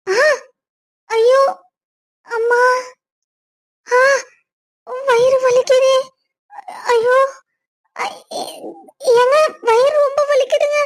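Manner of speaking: high, whimpering fake pain cries; faked stomach pain. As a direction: pretend to be in agony to get his attention. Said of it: "நான் சும்மா சொன்னேன்". (பொய்யான வயிற்று வலி அழுகை)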